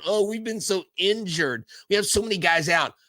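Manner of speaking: imitating a team whining about injuries; mock whiny team. Host mocking teams complaining about injuries, whiny. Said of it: "crying, going".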